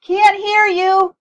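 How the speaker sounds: high, drawn-out full yell; shouting back. A narrator's reading. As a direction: bellow back over the blaring TV. Dad yells back over blaring TV.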